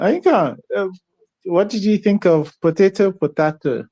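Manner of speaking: ordinary talk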